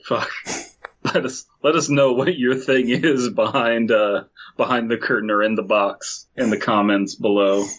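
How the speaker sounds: normal talking voice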